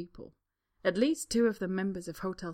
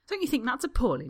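mock outrage; 6 semitones higher, 7 dB louder than usual. Harry hams up outrage.

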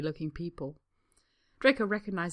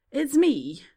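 repeated blurt; 7 semitones higher, 10 dB louder than usual. A narrator's reading. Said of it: "Draco repeats".

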